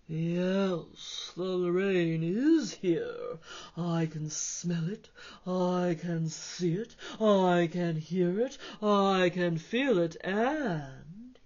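a lightly voiced big cat; deep purr. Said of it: "spoke in a deep purr".

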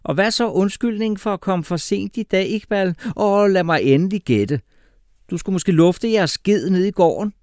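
loud, high, scornful teacher voice; sarcastic teacher. A narrator's reading. Horrible teacher mocking late pupil's excuse, loud and scornful. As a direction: taunt the latecomer at high volume.

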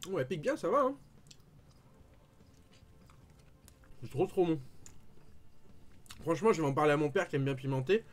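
talking while chewing food; eating spicy sandwich; mouth full, chewing. Real, not acted. Seen 0: bites sub sandwich at 3.6-4.4, talks while chewing throughout.

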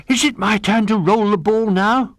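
panting, then high, loud begging; eager dog. A narrator's reading. Ruff pesters friends for his turn, panting and eager. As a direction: pant and ask like an excited dog.

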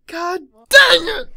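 high, loud, drawn-out curse; exasperated yell. Real, not acted. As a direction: explode with frustration, stretching the words. Frustrated at dying.

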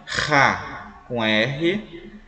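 soletrando "Ra com R" bem devagar. Shown as a demonstration. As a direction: escande cada sílaba para o aluno ouvir. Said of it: "Som de R". ("Ra com R" lento e marcado, como ditado)

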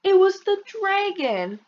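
put-on high, slow child blaming the dragon; squeaky kid excuse. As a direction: drag it out like a guilty little boy. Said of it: "you'll explain".

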